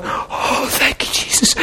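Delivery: whispered, tearful thanks; crying whisper. Retold or imitated. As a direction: breathe it out like she's weeping. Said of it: "crying, going".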